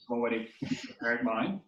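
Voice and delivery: ordinary talk, nothing marked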